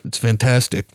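flat, lifeless line read; deadpan movie line delivery; bad acting. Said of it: "so many deliveries in this movie that sounded so bad".

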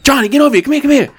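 very high, insistent retold call; calling someone over. Dealer keeps summoning the manager, calling excitedly. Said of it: "she calls him over".

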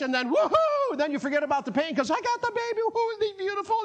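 a delighted new mother's whoop; joyful mother's cheer. Pretend. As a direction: cheer over the new baby. Mother cheering after birth.

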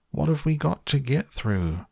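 high, alarmed-sounding question; questioning shout. A narrator's reading. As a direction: call it out high without much extra volume. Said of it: "shouted Grandpa Joe".

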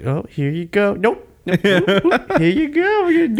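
high, sing-song teasing. Pretend. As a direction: dangle it and snatch it. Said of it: "And they keep".

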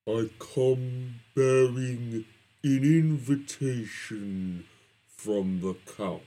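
slow, grave messenger; ominous coachman voice. Acted. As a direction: deliver it like a sinister herald. Coachman speaks at scene end.

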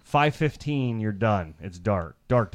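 ordinary talk

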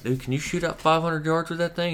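high-pitched wide-eyed question; feigned awe. Retold or imitated. Customer trolling the clerk about range, mock-amazed. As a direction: play the easily impressed novice.

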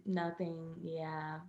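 ordinary delivery

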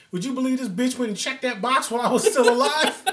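high, loud retelling through laughter; mock outrage, laughing; laughing disbelief. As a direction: crack up while quoting the outraged punchline. Retelling comic's outraged punchline, laughing.

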